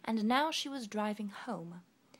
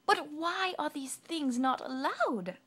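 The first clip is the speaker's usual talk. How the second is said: high, slow bewildered ask; puzzled; 8 semitones higher, 6 dB louder than usual. Acted. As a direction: ask in wonder. Sunshine asks.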